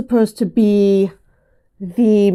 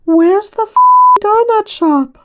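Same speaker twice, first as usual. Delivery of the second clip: put-on high, small toddler voice, with a cut-off near-swear; little three-year-old voice; 11 semitones higher, 4 dB louder than usual. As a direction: pipe up like a tiny kid from the back seat. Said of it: "in his little three-year-old voice".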